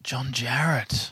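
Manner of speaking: soft, slow whispered echo; hushed whisper. Real, not acted. Host echoes the actor's name back, whispering.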